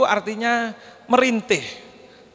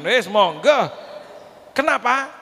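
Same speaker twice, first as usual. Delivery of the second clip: ordinary talk, nothing marked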